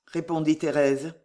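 ordinary delivery, nothing marked

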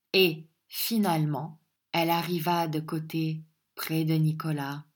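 plain reading voice

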